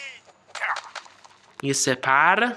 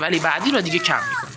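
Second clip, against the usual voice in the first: ordinary talk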